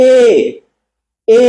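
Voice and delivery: a drawn-out demo e, tongue forward; demo vowel e. Shown as a demonstration. Teacher sounding out এ for students. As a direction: sound out এ slowly. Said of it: "যখন আবার এ বলবো".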